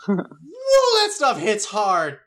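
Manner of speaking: high, slow gasp after strong liquor; wheezing after strong drink. Acted. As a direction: choke out the words after the drink. Strong beer downed.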